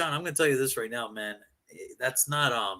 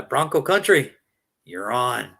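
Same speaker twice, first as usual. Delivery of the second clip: usual speaking voice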